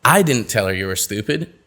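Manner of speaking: "I" punched with a rise at the end; stress on I. Shown as a demonstration. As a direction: hit the word "I" hardest. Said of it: "change the meaning of the same sentence".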